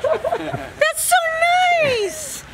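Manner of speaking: high-pitched delighted cry with laughter; thrilled. Real, not acted. Mom thrilled by pool gift.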